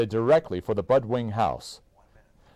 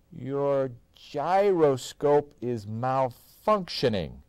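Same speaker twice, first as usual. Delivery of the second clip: slow, odd announcing voice; slow card voice; 6 semitones higher, much slower than usual. A narrator's reading. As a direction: read it out slowly like a machine. Card voice read.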